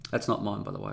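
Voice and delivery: normal talking voice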